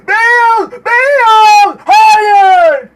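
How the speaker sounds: loud high cries of a name turning to no; howling as the chance slips away; anguished shout. Real, not acted. Gamer yelling at Bale as he misses.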